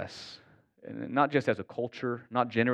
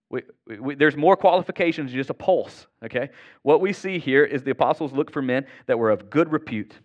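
preaching; 4 semitones higher, 6 dB louder than usual. Acted. Urging church plant standards.

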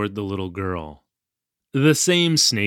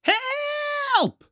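long, very high cry for help; calling out. A narrator's reading. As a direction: stretch the cry out high, not at full volume. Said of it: "kept calling out".

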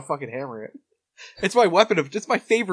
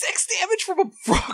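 outraged disbelief. Real, not acted. Outraged at damage.

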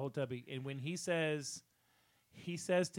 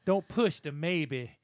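quoting movie line; 6 dB louder, slower than usual. Retold or imitated. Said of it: "When he tells her".

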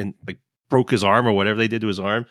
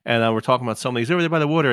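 ordinary talk

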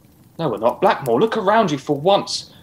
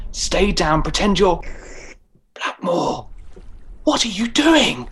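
ordinary talk

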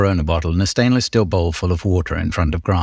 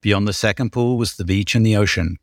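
ordinary talk

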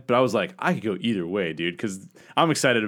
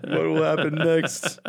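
teaser announcer voice; 2 semitones higher than usual. Pretend. Mock clickbait teaser.

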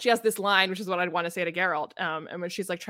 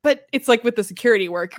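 normal talking voice

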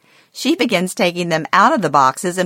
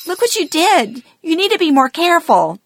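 much higher, fast mother's scolding; 12 semitones higher, 4 dB louder than usual. Retold or imitated. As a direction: play the mom chiding her careless daughter. Mom scolds after dropped ornament.

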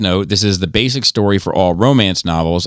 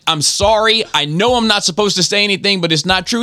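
pitched-up mock apology, still insisting; retold apologetic protest; 12 semitones higher than usual. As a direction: plead your objection in a high voice.

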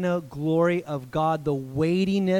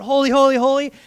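calling out holy, higher and louder; crying out holy; 7 semitones higher, 6 dB louder, faster than usual. Acted. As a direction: proclaim holy, ringing out. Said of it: "the other one would cry out".

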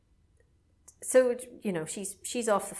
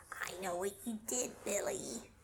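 hushed, much softer sinister taunt; eerie voice; 10 dB quieter than usual. Retold or imitated. Creepy caller taunting Billy, hushed and sinister. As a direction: murmur it like a creepy voice on the line. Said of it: "the voice is going".

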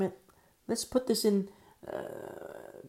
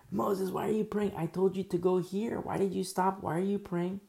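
higher-pitched, insistent scolding questions; exasperated Lord; 5 semitones higher than usual. Acted. The Lord asks Moses why he stopped, exasperated. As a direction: challenge him in disbelief, pitched up.

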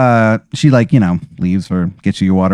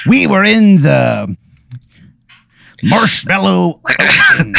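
higher, slow, dramatic pirate storytelling; Captain Crunch; 8 semitones higher, slower than usual. Acted. Sea captain begins his tale of disaster, slow dramatic pirate. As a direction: draw out the sea yarn with grave wonder. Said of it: "he goes".

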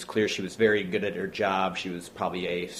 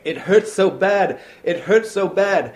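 high, loud, slow pained scream; 11 semitones higher, 10 dB louder than usual. Retold or imitated. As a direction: wail it like a broken leg.

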